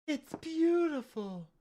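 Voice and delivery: mock awestruck. Teasing about dried-up palette.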